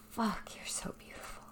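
ordinary delivery

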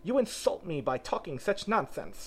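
high-pitched indignation; offended scolding. A narrator's reading. Offended mouse scolds Alice.

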